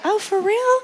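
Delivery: high, amazed disbelief; mock surprise. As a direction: squeak it like sudden news. Retelling surprise at beetle benefits.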